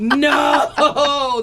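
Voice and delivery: sudden high laughing "No!"; dismayed cry. Retold or imitated. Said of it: "I drop all my money".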